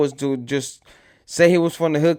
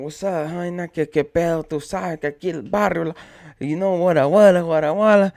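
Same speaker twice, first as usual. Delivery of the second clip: loudmouth. Retold or imitated. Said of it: "here comes this loudmouth fool".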